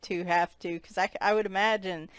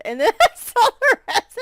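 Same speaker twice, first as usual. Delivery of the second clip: loud laughing through words; 14 semitones higher, 11 dB louder, faster than usual. Real, not acted. Laughing at picture.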